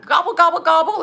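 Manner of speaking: high, loud playful gobbling; teasing a puppy with turkey gobbles. Retold or imitated. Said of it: "we used to go to Tucker".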